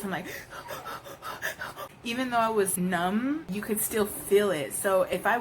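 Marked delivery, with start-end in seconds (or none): 0.3-2.0 breathless gasp of pain, no voice; showing the audience her gasp during labor; sharp gasp. Retold or imitated. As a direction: demonstrate the gasp as it hit. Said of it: "I'm like this".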